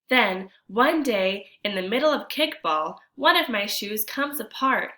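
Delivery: put-on expressive read-aloud for children; storybook narration. A narrator's reading. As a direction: tell the story to the class with feeling. Reads picture book aloud.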